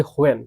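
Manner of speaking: "হোয়েন" with an h sound before the w; mispronounced when, "হোয়েন", opening on a breathed h; wrong pronunciation. Shown as a demonstration. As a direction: say "হোয়েন" as ho-en, h first. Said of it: "এটা কিন্তু হোয়েন হবে না".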